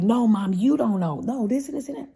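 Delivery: high, loud insistent arguing; arguing with mom. Retold or imitated. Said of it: "but I'm like".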